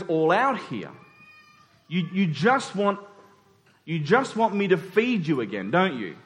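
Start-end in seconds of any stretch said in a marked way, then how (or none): none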